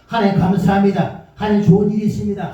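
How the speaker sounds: proclaimed thanks. Pretend. Model confession of thanks.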